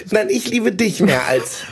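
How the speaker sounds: giggly late night call. Pretend. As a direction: coo it like a smitten teen on the phone. Lovers' mock phone goodbye. (kichernd wie beim nächtlichen Verliebten-Telefonat)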